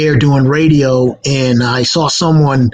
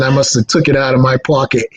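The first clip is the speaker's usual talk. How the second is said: normal talking voice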